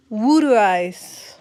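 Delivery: "water ice" said slowly as "wooder ice"; drawn-out "water ice" with a Philly oo. Shown as a demonstration. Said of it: "trying the Philly word".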